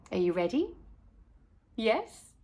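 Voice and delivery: ordinary delivery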